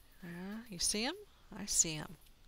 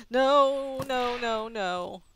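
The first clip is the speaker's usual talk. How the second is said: much louder, higher panicked cries fading down; 5 semitones higher, 9 dB louder, much slower than usual. Real, not acted. Player suddenly attacked, panicked cries. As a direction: yelp in alarm then trail off.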